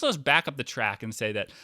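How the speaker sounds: ordinary delivery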